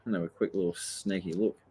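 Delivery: usual speaking voice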